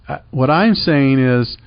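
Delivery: ordinary talk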